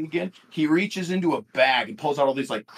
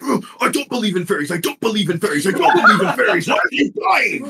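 high chant building to a shout; panicked troll; 5 semitones higher, 4 dB louder than usual. Acted. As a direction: repeat it faster in panic, end yelling. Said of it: "he's like".

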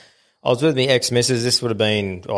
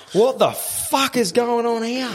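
much higher, slow incredulous demand; 10 semitones higher, slower than usual. Retold or imitated. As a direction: ask the crew in total disbelief. Demanding answers from the crew.

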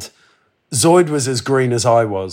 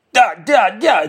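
mock yell, much higher and louder; yelling in Russian; 9 semitones higher, 5 dB louder than usual. Old babushka yelling at the traveller in Russian, mock shout. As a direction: bark it like an outraged babushka. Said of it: "yelling in Russian".

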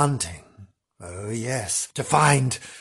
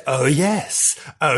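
ordinary talk

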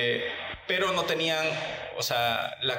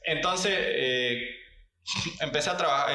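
ordinary talk

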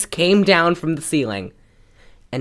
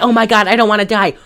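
higher, hurried retold cry of panic; mock panicked yell; 6 semitones higher, 4 dB louder, much faster than usual. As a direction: lightly imitate a frantic woman yelling. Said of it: "Betty is yelling".

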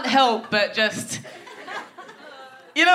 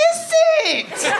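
high incredulous. Retold or imitated. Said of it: "I was like".

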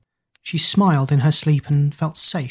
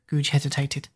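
ordinary delivery, nothing marked

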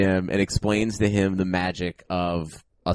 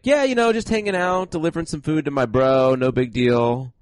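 high, put-on girl's voice easing down; casual girl; 5 semitones higher than usual. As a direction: play her breezy and nonchalant, starting high. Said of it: "And she's like".

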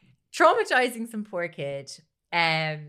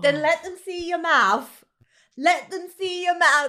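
high, loud, insistent mimicry; imitated coaching mother; 7 semitones higher, 5 dB louder than usual. Mother directs her son's crying face for viewers, mock pushy. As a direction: push the repeated instruction like a stage mum. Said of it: "instructs him to".

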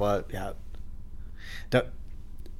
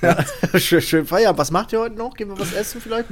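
high, giddy mock millionaire; shrieking jackpot winner; 7 semitones higher, faster than usual. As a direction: squeal it like a lottery winner. Ja, wir sind Millionäre.

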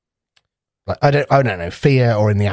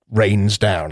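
ordinary talk

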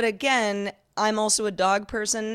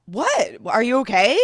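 startled; 4 semitones higher, 5 dB louder than usual. Retold or imitated. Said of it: "I was, like, startled".

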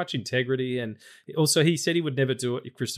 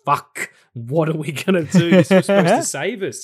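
loud, higher-pitched comic despair; mock panic; 4 semitones higher, 9 dB louder than usual. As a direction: wail like a doomed studio executive, hammed-up. Voicing studio staff seeing the figures.

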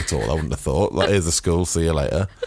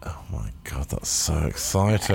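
sarcastic whisper; 3 semitones lower, 4 dB quieter than usual. Pretend. Mock awe at the chair.

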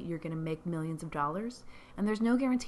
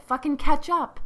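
exasperated shout; 8 semitones higher, 12 dB louder than usual. Real, not acted. Urging viewers to be alive.

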